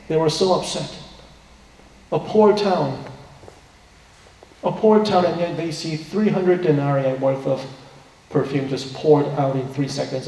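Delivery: ordinary talk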